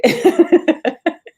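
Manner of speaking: loud high laugh; burst of laughter. Real, not acted. Woman laughing at her own bad luck, loud laugh.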